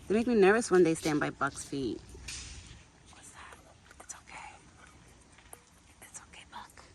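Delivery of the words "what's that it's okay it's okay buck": whispered reassurance, barely voiced; soft whisper. Real, not acted. Woman whispers to calm her deer at a noise next door. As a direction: hush him gently in a whisper.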